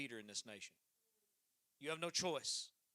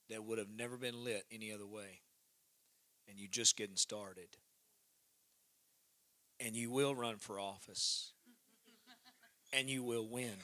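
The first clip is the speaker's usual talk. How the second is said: ordinary delivery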